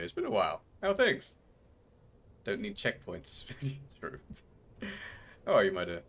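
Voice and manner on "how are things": high cooing question. Acted. Greeting a pet.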